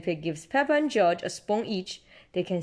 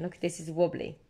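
plain reading voice

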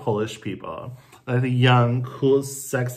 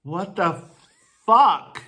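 outraged; 6 semitones higher, 3 dB louder, slower than usual. Real, not acted. Outrage over abortion ban.

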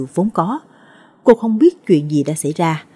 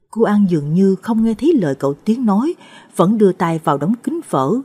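plain reading voice

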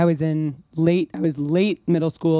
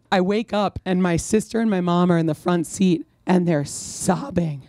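ordinary talk

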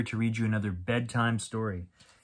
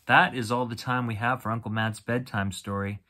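ordinary delivery, nothing marked

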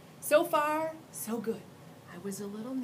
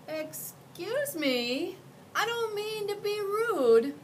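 put-on higher, slow and careful child voice; polite child; 12 semitones higher, 5 dB louder, slower than usual. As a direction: ask timidly so as not to offend. Child asks monster politely.

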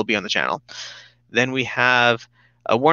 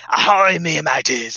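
pirate voice; 4 semitones higher, slower than usual. Acted. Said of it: "Please do an entire video on my pirate voice".

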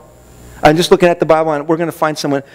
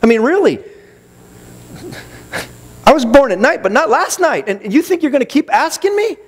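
higher-pitched mock protest; incredulous, pitched up in protest; acted indignant thought; 8 semitones higher than usual. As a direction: voice a fed-up believer refusing to be fooled. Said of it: "and you're thinking".

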